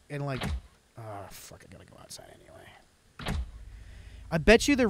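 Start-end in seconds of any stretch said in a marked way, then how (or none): 1.0-3.4 whisper. Acted. Whispered aside.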